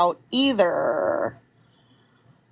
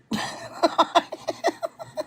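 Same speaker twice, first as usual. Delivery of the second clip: incredulous laugh; 5 dB quieter than usual. Real, not acted. Disbelief at finally no fight.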